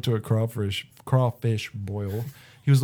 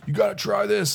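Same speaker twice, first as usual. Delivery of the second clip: pushy boss; 9 semitones higher than usual. Retold or imitated. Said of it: "He's like".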